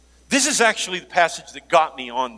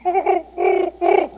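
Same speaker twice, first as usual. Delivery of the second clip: high, far-off cooing call; squeaky mock dove coo; dove coo imitation; 14 semitones higher than usual. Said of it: "that's better than my eagle".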